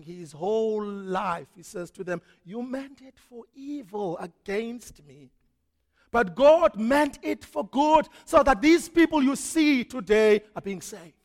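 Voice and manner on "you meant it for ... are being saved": preaching Joseph's words. Acted. Joseph to his brothers, building to a loud proclamation. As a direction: rise from calm to a triumphant proclamation.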